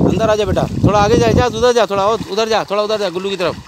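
calling out. Real, not acted. Father shouting to son wading into the water.

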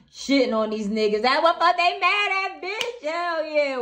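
loud, high whooping hype; gleeful hollering. Real, not acted. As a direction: holler it way up high, crowing in triumph. Gleefully hyping up the client.

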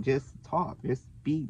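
quiet, restrained voice; just talking. Retold or imitated. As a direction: drop the volume and keep it simple. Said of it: "trying not to switch my voice so much".

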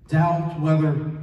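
ordinary talk, nothing marked

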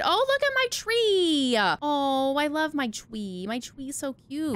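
high, slow doting coo. Real, not acted. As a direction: dote on it like a pet. Admiring her decorated base tree.

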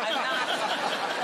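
usual speaking voice

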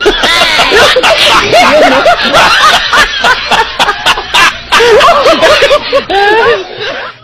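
loud, long laughter that keeps going; helpless laughing fit. Real, not acted.

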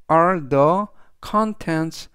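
very slow, deliberate example sentence; slow model reading. Shown as a demonstration. Teacher reading the example aloud to students, slow and clear. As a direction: spell each word out for learners.

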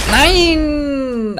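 high drawn-out wail of no; frustrated cry. Real, not acted. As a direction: wail the no in defeat. Versuch scheitert. (langgezogenes hohes Nein)

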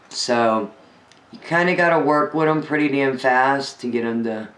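usual speaking voice

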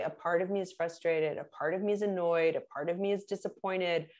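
calm, softly spoken softener; calm gentle phrasing. Shown as a demonstration. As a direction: say it gently to defuse. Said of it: "when you say".

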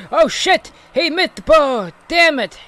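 loud frustrated curse at a missed ball; angry outburst. Real, not acted. Gamer shouting at his own missed shot. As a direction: yell it, annoyed at the miss.

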